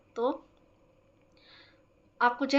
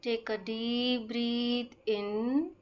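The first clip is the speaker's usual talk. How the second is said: very slow, measured meditation voice with stretched-out words and long pauses; guided breathing meditation; much slower than usual. Acted. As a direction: guide them slowly through each breath. (धीमी, शांत ध्यान कराने वाली आवाज़)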